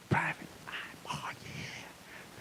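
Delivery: breathy noir whisper, almost no voice. Acted. Comedian whispering his private-eye movie tagline. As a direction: hush it like a film noir teaser. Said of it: "whispered private eye bit".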